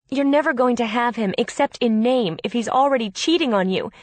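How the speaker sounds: exasperated, forceful argument; angry insistence. A narrator's reading. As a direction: hammer the truth home to your sister. Sister argues against cheating fiancé.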